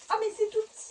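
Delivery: high pitched disbelief at the size; dismayed surprise. Real, not acted. Showing viewers a tiny holder, high dismayed surprise. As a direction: shoot the voice up, let down and amazed.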